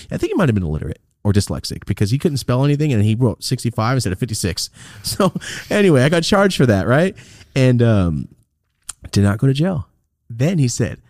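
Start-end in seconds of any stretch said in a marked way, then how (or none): none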